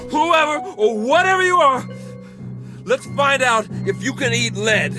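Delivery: high, loud, frenzied challenge; shouted threat. Acted. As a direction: yell a challenge with gun in hand. Threatening visitors with a gun.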